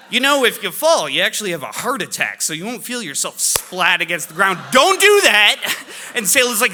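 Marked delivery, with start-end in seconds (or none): 0.1-4.6 high, loud imitation of a taunting kid; imitated sibling taunt, pitched up and loud; needling little sister. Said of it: "she kinda twists that knife". 4.7-5.8 very high, loud, drawn-out shout; panicked dad shouting a reprimand; alarmed scolding yell. Retold or imitated. Dad cuts off the taunting sister.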